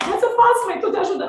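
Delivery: high, loud imitation of son protesting; retold protest. Son insists to mother that he helps, protesting high and loud. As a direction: argue back urgently, pitch right up.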